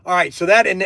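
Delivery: ordinary talk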